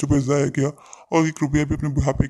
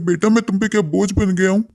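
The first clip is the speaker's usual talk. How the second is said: put-on higher-pitched pleading old man; hurt old father; 6 semitones higher, 4 dB louder than usual. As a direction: ask if he has become a burden. (ऊँची आवाज़ में आहत बूढ़े पिता)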